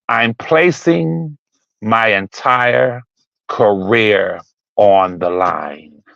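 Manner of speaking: slow and low, every word weighed; grave quote. Retold or imitated. Host quoting the editor slowly and gravely. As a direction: read the editor's line slowly, like a solemn vow.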